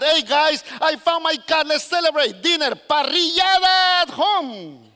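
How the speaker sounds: high, loud, stretched-out party call; hammed-up hollering to the whole crowd; mock jubilation. Preacher plays someone inviting guys over for a found cat, hammed-up.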